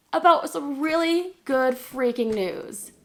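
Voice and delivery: slow, high, drawn-out emphasis on "really good freaking news"; "really good freaking news" spelled out slowly and pointedly; emphatic hinting. Retold or imitated. Pointed hint to dad, retold.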